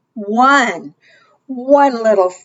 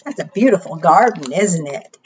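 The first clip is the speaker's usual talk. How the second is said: normal talking voice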